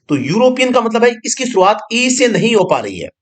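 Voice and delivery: normal talking voice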